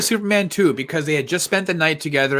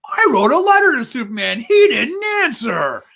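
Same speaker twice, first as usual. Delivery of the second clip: hammed-up, high mock outrage; overacted angry kid; 7 semitones higher than usual. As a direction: overact a furious child at a podium. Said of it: "School of overacting".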